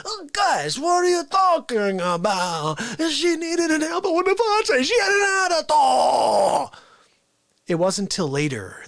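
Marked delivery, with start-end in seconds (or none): 0.1-6.8 Fauci protesting. Retold or imitated. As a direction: defend the elbow to the bosses. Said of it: "And he's like".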